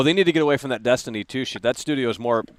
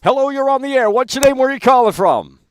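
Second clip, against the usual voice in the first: higher, louder host call-out; loud caller greeting; 8 semitones higher, 6 dB louder than usual. Real, not acted. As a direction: project it at a faint caller.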